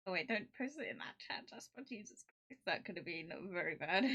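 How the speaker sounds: ordinary talk, nothing marked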